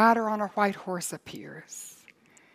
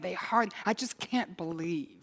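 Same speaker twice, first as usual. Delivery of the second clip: usual speaking voice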